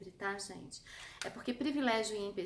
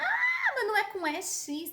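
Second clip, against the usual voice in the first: shrieking high, loud whiny objection; mock protesting student; 11 semitones higher, 7 dB louder than usual. Imitating a student who objects, loud and shrill high. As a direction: screech the protest like an indignant pupil. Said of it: "Tem gente que fala".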